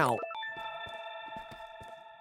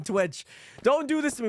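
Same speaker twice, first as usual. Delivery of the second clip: normal talking voice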